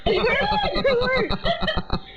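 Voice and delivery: high, delighted laughing exclamation; laughing in disbelief. Real, not acted. Twin laughing as her sister's face unlocks her phone. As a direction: burst out laughing as the phone opens.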